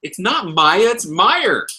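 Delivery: high-pitched retold astonishment; amazed realization. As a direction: relive the moment it clicked, thrilled. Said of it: "I'm like".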